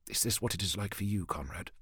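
hushed, low musing; soft and low, as if thinking to himself; inward thought. Acted. Commander silently addressing Conrad in thought, hushed musing. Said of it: "he thought".

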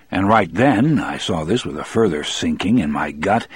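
usual speaking voice

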